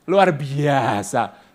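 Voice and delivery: very slow, stretched luar biasa; drawn out. Shown as a demonstration. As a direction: draw out luar biasa syllable by syllable.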